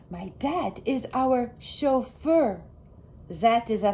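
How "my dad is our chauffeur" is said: usual speaking voice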